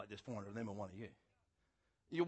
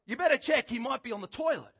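loud, raised teasing jibe; mocking taunt; 11 semitones higher, 10 dB louder than usual. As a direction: poke fun at the absent god. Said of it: "Elijah starts mocking them".

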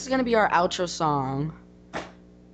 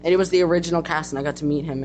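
ordinary talk

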